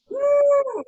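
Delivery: a high, loud mock whoosh; whooshing woo. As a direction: fling the sound up like an arm flying overhead. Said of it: "And then it goes".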